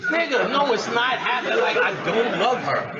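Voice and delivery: high, loud refusal; loud laughing denial; emphatic no. Real, not acted. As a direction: shut the idea down hard. Rejecting Bobby's matchmaking.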